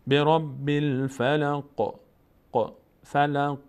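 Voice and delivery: برب الفلق with the final ق echoing lightly; slow Quranic recitation, ق vibrating; slight qalqala on ق. Shown as a demonstration. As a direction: let the ق of فلق bounce a little, no vowel after.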